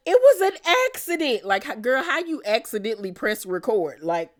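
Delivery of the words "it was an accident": mock Brittany excuse. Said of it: "Brittany was like".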